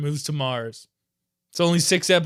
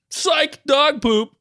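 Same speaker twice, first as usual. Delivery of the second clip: ordinary talk, nothing marked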